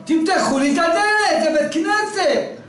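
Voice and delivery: calling out from the cold; a hammed-up shout to open the door; pleading shout. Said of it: "הוא צועק".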